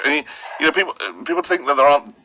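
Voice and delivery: ordinary delivery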